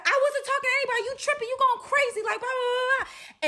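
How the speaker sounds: very high, loud, rapid squabbling; shrieky imitation of an arguing girl; mock furious rant. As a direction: rattle it off in a hammed-up argument voice. Acted back-and-forth argument.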